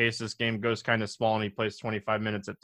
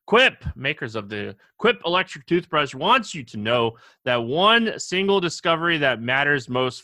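upbeat, punchy sponsor read; 5 dB louder than usual. Acted. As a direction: sell it like a radio ad. Reads a sponsor ad.

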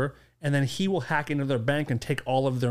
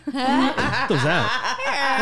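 slow, laughing, higher question; laughing disbelief; 10 semitones higher, much slower than usual. Real, not acted. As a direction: crack up mid-question. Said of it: "That was, like, two voices".

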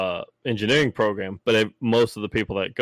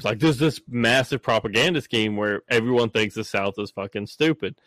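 ordinary talk, nothing marked

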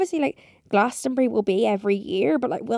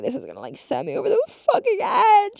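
near tears; 9 semitones higher than usual. Retold or imitated. Quoting herself in her room, close to crying over the sneezes. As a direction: voice cracking, almost crying. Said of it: "sat in my room being like".

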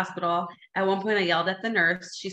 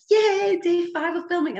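high, drawn-out celebratory yay; sing-song cheer, pitched way up; mock group cheer; 11 semitones higher, 4 dB louder, slower than usual. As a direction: imitate a happy crew cheering the day's wrap. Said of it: "Everyone was in such a good mood".

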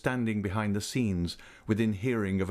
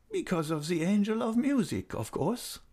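put-on high, tender old lady's voice; fond old lady; 11 semitones higher than usual. A narrator's reading. Old lady invites Raoul near, voice high and fond. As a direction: coax him close like a loving old aunt.

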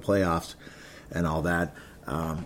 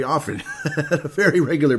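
laughing; 5 semitones higher than usual. Real, not acted. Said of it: "laughs while talking".

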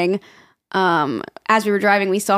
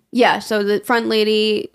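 ordinary delivery

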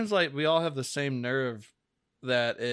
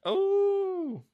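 mock amazed gasp; 5 dB louder than usual. Infomercial bit, high gasp.

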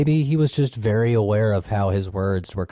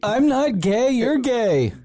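very high-pitched whiny-kid impression; mock upset child; 14 semitones higher, slower than usual. Co-host joins in imitating the teased eight-year-old, mock-inconsolable. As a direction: squeal it like a kid's comeback, hammed-up. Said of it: "just inconsolable and kept yelling".

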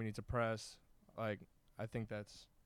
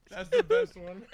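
said through laughter; laughing it out; 13 semitones higher, 12 dB louder than usual. Real, not acted. Laughing at his own joke.

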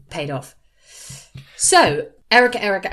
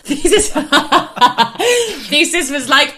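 louder, high, laughing through the words; 8 semitones higher, 10 dB louder than usual. Real, not acted. [name] retelling the thesis story to James, laughing hard. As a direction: crack up mid-sentence.

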